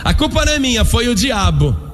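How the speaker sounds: high-pitched protest, dragging out the ending; protesting serpent. Acted. Serpent voiced shifting blame.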